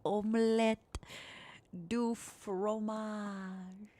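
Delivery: French accent, fading softly on the last words. Shown as a demonstration. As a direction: draw it out in a hammed-up French accent. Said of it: "in a soft French accent voice".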